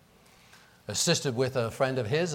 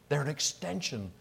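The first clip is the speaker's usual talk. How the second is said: ordinary delivery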